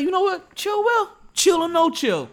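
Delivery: high, loud eureka moment; excited idea. Retold or imitated. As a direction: shout out the new idea. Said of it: "I was like".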